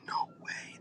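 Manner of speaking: hushed, voiceless disbelief; stunned whisper. Retold or imitated. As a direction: whisper it as if in shock. Retelling own stunned disbelief.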